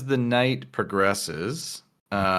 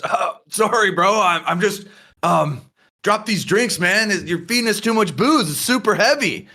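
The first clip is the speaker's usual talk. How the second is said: lightly voiced drunk, pitched higher; a sheepish, tipsy excuse, raised a bit; drunk apology; 8 semitones higher than usual. Drunk patron apologizes to barman.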